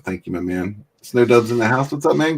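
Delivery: normal talking voice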